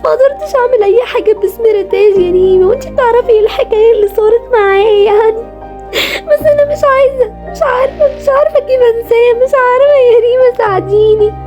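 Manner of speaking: high, crying, pleading voice; tearful crying. Acted. Ritaj crying to Reem that she still loves him. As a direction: sob out the words, voice breaking. Ritaj sobbing confession to friend.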